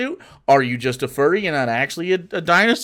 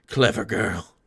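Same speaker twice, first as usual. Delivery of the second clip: put-on quoting clever girl; 9 semitones lower, 6 dB quieter, slower than usual. Jurassic Park quote.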